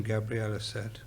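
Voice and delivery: ordinary delivery, nothing marked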